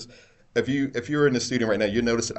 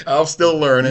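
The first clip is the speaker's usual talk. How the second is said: loud laughing repeat; laughing echo; 7 semitones higher, 11 dB louder than usual. Real, not acted. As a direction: laugh it back. Echoes with laugh.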